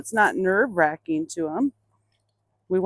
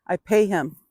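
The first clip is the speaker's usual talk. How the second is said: ordinary talk, nothing marked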